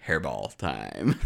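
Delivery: low, slow ominous line. Acted. Narrating cat's move.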